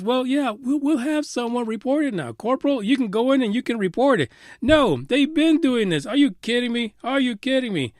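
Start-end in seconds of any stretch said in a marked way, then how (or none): 0.0-4.3 higher-pitched sarcastic imitation of brass; mocking the officials. Host mocks military officials promising reports, sarcastic higher voice. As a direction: parrot the officials like they're fools. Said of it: "now they're telling us".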